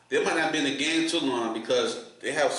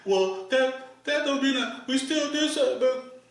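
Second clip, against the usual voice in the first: high, whiny mock voice of the rival fan; 11 semitones higher than usual. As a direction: whine it up high like a sore loser.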